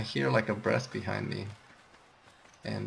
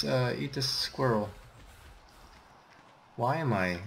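ordinary delivery

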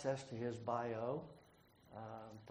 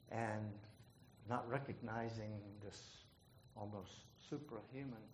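ordinary talk, nothing marked

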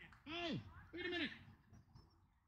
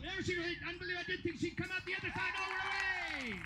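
raised, lively commentator voice; live play-by-play; 5 semitones higher than usual. Acted. Announcer calling a dog agility run, lively play-by-play. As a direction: call the run as it happens.

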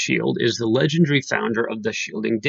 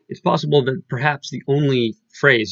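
usual speaking voice

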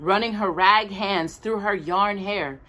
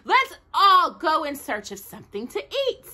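eager; 10 semitones higher, 4 dB louder than usual. A narrator's reading. Tin soldier proposes food hunt.

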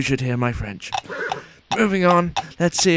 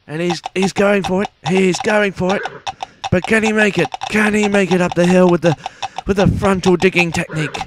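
excited commentary; 6 semitones higher, 5 dB louder than usual. Pretend. Commentator race climax.

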